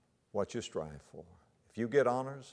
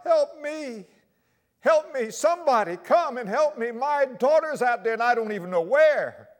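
much higher and louder cry for help; desperate shout; 13 semitones higher, 10 dB louder than usual. Retold or imitated. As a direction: yell to the beach for help. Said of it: "he began to shout".

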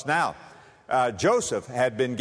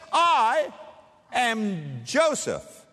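high, drawn-out I, then slow am Joseph; dramatic reveal; 7 semitones higher, much slower than usual. Acted. Joseph revealing himself to his brothers, slow and high. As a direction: unveil yourself to stunned brothers. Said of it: "and he says".

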